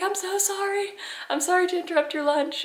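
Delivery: lightly imitated high apologetic plea. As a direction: gush sorry at the driver. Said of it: "I was apologetic about it".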